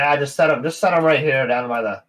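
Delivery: high, loud gnome voice; gnome barkeep. Acted. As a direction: wave them over to the fire. Gnome directs.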